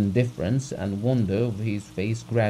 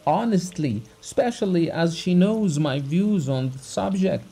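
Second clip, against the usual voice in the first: raised, pleading voice near tears; tearful heartfelt plea; 6 semitones higher, 4 dB louder than usual. Acted. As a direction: pour out the heart, hurt and wronged. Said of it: "With tears in his eyes, trembling all over".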